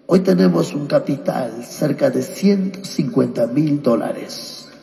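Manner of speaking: ordinary talk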